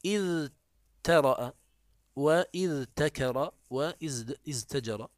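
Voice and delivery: slow, each form pronounced apart; slow spelled-out forms. Shown as a demonstration. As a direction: spell the original forms out slowly. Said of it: "والأصل". (نطق الأصل ببطء)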